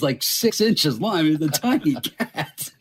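ordinary delivery

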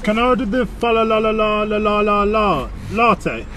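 ordinary delivery

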